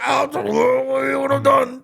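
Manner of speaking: put-on high, loud drunken wail; wailing misery. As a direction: groan an answer from the toilet floor, hammed-up. Vomiting drunk answers the knocker.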